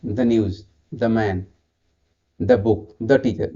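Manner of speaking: the को छोटा द बोलो; the news में the को द कहो. Shown as a demonstration. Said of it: "इसको कहीं द कहते हैं". (व्यंजन से पहले the, द जैसा)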